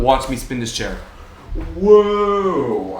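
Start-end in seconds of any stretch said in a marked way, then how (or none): 1.8-3.0 high, loud gleeful whoa; excited whoa. Real, not acted. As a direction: cry out with delight while spinning. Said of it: "I'm spinning in a chair".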